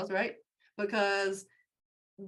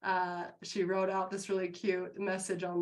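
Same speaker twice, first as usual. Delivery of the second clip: usual speaking voice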